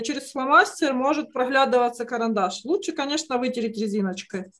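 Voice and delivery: ordinary delivery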